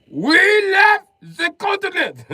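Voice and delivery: very high, slow shout; loud, high, exaggerated put-on voice; hammed-up Spain. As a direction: play Spain with a drawn-out high yell. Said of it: "Spain was like".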